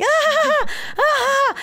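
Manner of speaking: high mock "ah, ah, ah"; imitated gleeful noise. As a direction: squeal like a grinning mom. Imitates mom grinning ear to ear.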